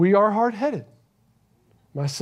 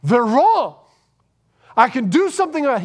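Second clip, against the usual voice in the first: loud, quick defiance, pitched up; imagined defiant retort; 5 semitones higher, 6 dB louder than usual. Pretend. Jesus, imagined answering his accusers, loud and quick. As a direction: snap back with quick, loud certainty. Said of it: "Could he have said".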